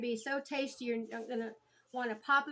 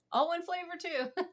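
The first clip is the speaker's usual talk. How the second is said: higher, amused laughing correction; 6 semitones higher than usual. Real, not acted. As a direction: laugh it off, pitch up. Corrects slip, still amused.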